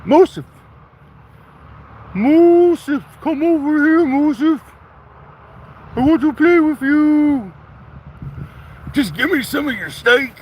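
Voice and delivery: high shouted call across the yard; loud calling. Real, not acted. Farmer hollers across the yard for Musif, high and loud. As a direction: holler it to a dog far away.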